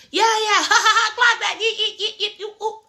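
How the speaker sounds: very high, laughing yappy Anna; squeaky hyper mock of Anna jumping around; chihuahua impression. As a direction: yip and bounce like an excited little dog. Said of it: "she just remind me of a chihuahua".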